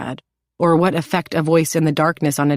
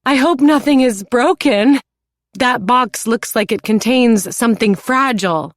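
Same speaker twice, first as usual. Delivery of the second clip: high, loud delighted voice; delighted exclaiming; 7 semitones higher, 4 dB louder than usual. A narrator's reading. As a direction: gush with pleasure. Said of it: "exclaims in delight".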